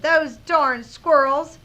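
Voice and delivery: slow, loud storybook grumble; grumpy old man. A narrator's reading. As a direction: exclaim it as the old man, short of a full yell. Character's closing line, read aloud.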